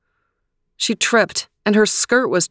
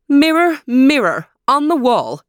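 high, loud Podcon-free royal call; queen's mirror summons; 7 semitones higher, 4 dB louder than usual. Acted. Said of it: "said the queen".

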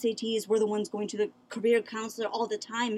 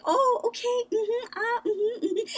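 very high, tiny polite hums with a laugh; meek polite agreeing; 13 semitones higher than usual. Retold or imitated. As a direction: squeak out meek little yeses while bowing. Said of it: "and then I'm like".

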